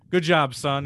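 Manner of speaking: choked-up proud "good job"; proud dad. Retold or imitated. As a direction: cheer him on proudly. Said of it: "I'm like".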